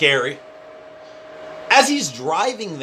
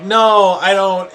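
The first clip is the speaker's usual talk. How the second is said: flustered loud no; 4 semitones higher, 5 dB louder, slower than usual. Retold or imitated. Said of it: "And I'm like".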